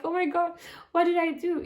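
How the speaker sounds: high, loud, mortified cry; panicked self-talk. Retold or imitated. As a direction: blurt it in embarrassed panic. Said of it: "I just felt like".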